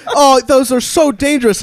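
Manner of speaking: high, mock-worried father; gingerly worried dad. Dad warns son about motorcycles, gingerly worried. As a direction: imitate a dad fretting gingerly. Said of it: "he's so gingerly when he talks".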